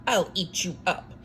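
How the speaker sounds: ordinary talk, nothing marked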